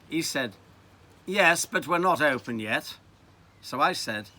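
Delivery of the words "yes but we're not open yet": louder posh English reply. Acted. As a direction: answer the child in a posh accent. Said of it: "in a very posh voice".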